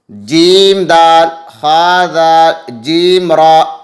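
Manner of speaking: slow letter drill. Shown as a demonstration. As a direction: spell out syllables for children, slowly and clearly. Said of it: "दाल पढ़ना है".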